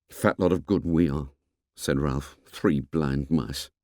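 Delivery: despondent. Acted. Ralph despondent.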